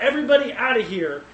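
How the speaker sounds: a touch of a ruler crying out to clear the room; retold cry out. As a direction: call the room clear, half-acted. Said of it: "he just cries out".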